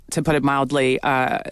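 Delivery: ordinary talk, nothing marked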